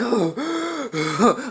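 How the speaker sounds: a soft wheezy gasp that turns into a laugh; wheezing gasp. Retold or imitated. Narrator mimes catching his breath, a wheezy half-laughing gasp. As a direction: mime being winded, half laughing. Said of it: "I was tired and wheezing".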